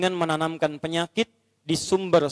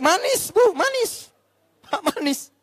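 much higher sugary squeal; wife's delighted voice; 13 semitones higher, slower than usual. Acted. Wife delighted by husband's sweet words, high coo.

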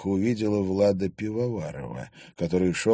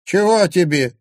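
high, louder grumpy crone; displeased old crone; 10 semitones higher, 8 dB louder than usual. A narrator's reading. Old woman grumbles at the journalist, high and cross. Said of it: "недовольно спросила она".

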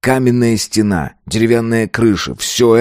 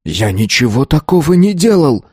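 raised-pitch indignant denial; protesting; 6 semitones higher than usual. A narrator's reading. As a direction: deny it urgently, voice lifted. Pupil protests against accusation. (возмущённо оправдываясь)

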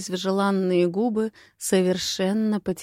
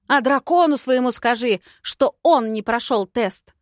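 loud, higher angry retort; heated shouted send-off; shouting; 5 semitones higher, 5 dB louder than usual. A narrator's reading. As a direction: yell the parting message after him. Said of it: "Я перешла на крик".

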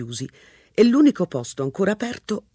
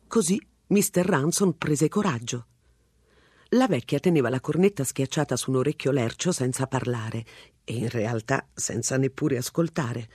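ordinary delivery, nothing marked

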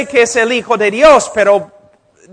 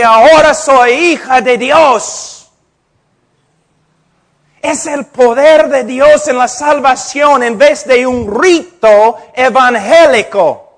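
louder, higher fervent preaching; preaching at full force; 4 semitones higher, 6 dB louder than usual. Acted. Preacher proclaims God's power to the congregation, at full force. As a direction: proclaim it from the pulpit.

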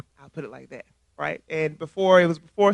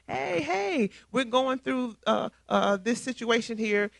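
much higher, squealing hello; all excited; 5 semitones higher, 4 dB quieter, slower than usual. Retold or imitated. Clique friends spotting their own friend, squealing excitedly. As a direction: greet your girl with a squeal. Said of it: "they're all excited".

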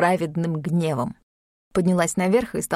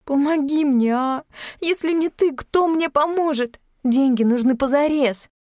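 плача; 7 semitones higher than usual. Acted. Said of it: "а Юлька заплакала".

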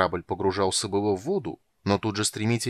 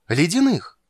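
high, loud, awestruck question; frightened, thrilled gasp; 9 semitones higher, 7 dB louder than usual. A narrator's reading. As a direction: gasp in fear and delight. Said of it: "испуганно восторженно ахнула Тигровая". (испуганно-восторженно ахая)

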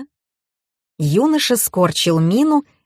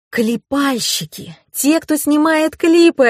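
slow, higher voice of dawning realization; slow, pitched-up eureka moment; sudden realization; 8 semitones higher, slower than usual. A narrator's reading. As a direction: let it dawn on you, drawing it out. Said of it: "осенило меня".

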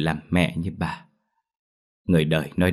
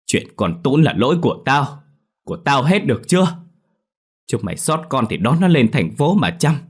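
high, raised, upset voice; tearful outburst; 6 semitones higher, 4 dB louder than usual. A narrator's reading. Weary grandmother refusing to care for the child, upset and high. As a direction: give up in bitter exasperation. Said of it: "Nước mắt đã chảy thành hai hàng".

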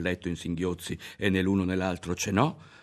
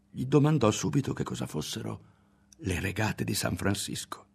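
plain reading voice

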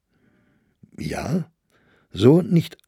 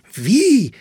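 sharp, much higher and louder cry of surprise; surprised exclamation; 14 semitones higher, 6 dB louder than usual. Acted. Young man hears the poet wrote a play, astonished outcry. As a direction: leap up on the word in astonishment. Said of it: "rief Maier aus".

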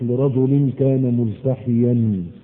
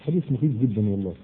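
hushed aside; 6 semitones lower, 7 dB quieter, faster than usual. Real, not acted. Awed aside after hadith.

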